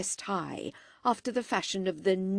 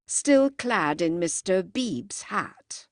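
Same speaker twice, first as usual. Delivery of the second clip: ordinary delivery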